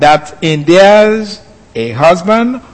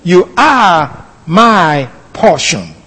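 slow, drawn-out reading of a verse; a measured, reverent declaration; much slower than usual. Shown as a demonstration. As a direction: savour each word slowly. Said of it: "that's how he acknowledged that relation".

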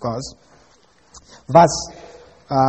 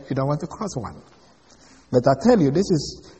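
ordinary delivery

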